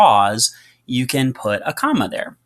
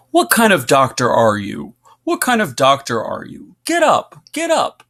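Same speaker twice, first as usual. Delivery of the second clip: louder, rising to high frantic cries; yelling nurse; 4 semitones higher, 4 dB louder than usual. Acted. Teacher reading the nurse shouting at the fainted doctor.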